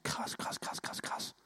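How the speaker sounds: breathless whispered wonder; whispered amazement. Retold or imitated. Preacher imagines listener's thought, whispering in awe. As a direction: whisper krass over and over in awe.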